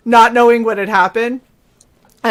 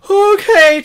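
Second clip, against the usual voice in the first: meek okay; 9 semitones higher, 4 dB louder than usual. Retold or imitated. Meek reply to scolding mom.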